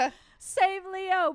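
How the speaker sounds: high, long drawn-out plea; mock anguished cry. Fan reacting to an actor's near-fatal jump, mock anguish. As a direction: wail it slowly, hammed-up despair. Acts out distress at Leo's near death.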